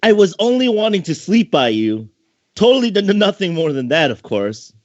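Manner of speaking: put-on high girlish voice, innocent act; high-pitched catgirl feigning innocence; little sister voice. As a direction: play the caught kid sister fibbing. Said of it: "she hoped he wouldn't figure her out".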